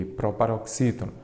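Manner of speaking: ordinary talk, nothing marked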